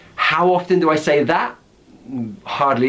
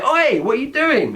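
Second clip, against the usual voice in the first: high sharp call of annoyance; annoyed oi; 10 semitones higher than usual. Shown as a demonstration. Teacher demos snapping at someone caught. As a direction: catch someone in the act. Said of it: "You might say".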